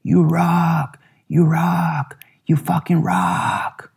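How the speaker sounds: high, drawn-out cheering scream; retold excited screaming. Production guy screaming at the band from his knees, retold scream. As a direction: imitate a wildly thrilled fan screaming praise. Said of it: "started screaming".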